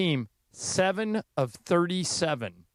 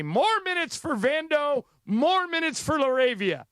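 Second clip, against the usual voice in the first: rallying cry; 10 semitones higher than usual. Pretend. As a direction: rouse the crowd for Vando and Laravia. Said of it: "And I say".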